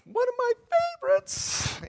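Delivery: squeaky high delight, breathy on the last word; playful high-pitched gush; gushing squeal. Real, not acted. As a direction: squeal it like a giddy fan. Gushing over a favorite topic.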